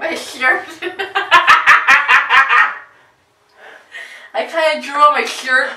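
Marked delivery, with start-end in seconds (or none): 0.7-2.8 loud laugh. Real, not acted. Big laugh.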